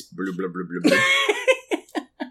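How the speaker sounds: droning blah then a squeaky high blah; mock chatter. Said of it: "Is that too real yet?".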